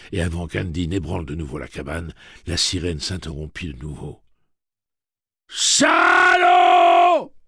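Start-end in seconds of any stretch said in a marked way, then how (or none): 5.5-7.3 very high, long-drawn bellow; one huge stretched shout; bawling. A narrator's reading. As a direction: bawl it out in robot fury. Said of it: "brailla Andy".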